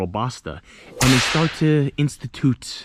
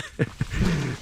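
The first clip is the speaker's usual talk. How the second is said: short laugh; 4 semitones higher, 4 dB quieter than usual. Real, not acted. Laughs at own joke.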